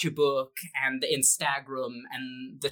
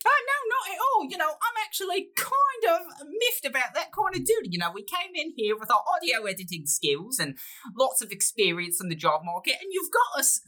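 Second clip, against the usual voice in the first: high put-on voice; 10 semitones higher than usual.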